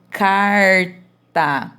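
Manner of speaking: CARTA split slowly as CAR, pause, TA; syllable by syllable. Shown as a demonstration. Teacher separating syllables to show final R, word broken in two. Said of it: "eu separei a sílaba".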